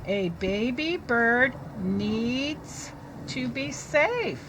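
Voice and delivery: slow read with a lift on safe; teacher reading to children; slow reading aloud. Shown as a demonstration. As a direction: read slowly, lift the last word.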